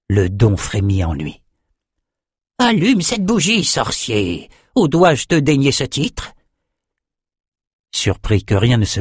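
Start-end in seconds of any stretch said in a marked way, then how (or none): 2.6-6.3 high, swooping challenge; taunting André. A narrator's reading. André presses Nathan over his sorcerer title, high and taunting. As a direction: goad the failing pupil in a high, teasing voice.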